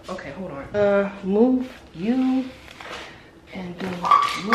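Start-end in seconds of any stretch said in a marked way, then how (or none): 0.1-0.6 very soft, to herself; muttering under breath. Real, not acted. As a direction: mumble it under your breath. Fumbling with camera.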